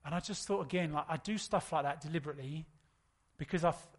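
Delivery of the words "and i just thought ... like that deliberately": usual speaking voice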